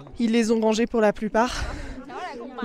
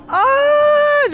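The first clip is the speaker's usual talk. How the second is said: dismayed exclamation; 14 semitones higher, 6 dB louder than usual. Real, not acted. Clouds return before camera.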